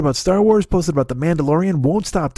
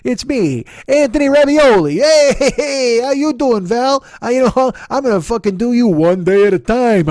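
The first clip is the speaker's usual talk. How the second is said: loud, higher mock Italian-American charmer voice; Italian guy; 7 semitones higher, 5 dB louder, slower than usual. Anthony Ravioli hitting on Valerie, loud hammed-up Italian voice. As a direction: ham it up as a smarmy Italian suitor. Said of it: "went straight for the fucking Italian guy".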